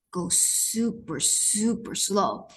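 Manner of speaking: slowing down. Shown as a demonstration. Illustrating slowness.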